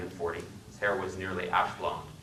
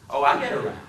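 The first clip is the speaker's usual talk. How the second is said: put-on 1 deep Southern accent; 5 semitones higher, 5 dB louder than usual. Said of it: "His speech was the deepest South".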